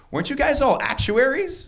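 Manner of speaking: high, incredulous mock question; skeptical question.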